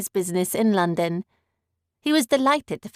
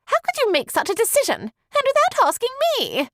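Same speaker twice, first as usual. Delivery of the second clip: high, fast outraged lady's voice; angry cry; faster than usual. A narrator's reading. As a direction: cry out angrily, much higher and quicker. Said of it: "Miss Bingley cried out angrily".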